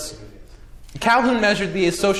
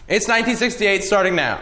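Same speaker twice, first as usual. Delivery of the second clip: dramatic narrator; 4 semitones higher than usual. Acted. Dramatic documentary-style time intro.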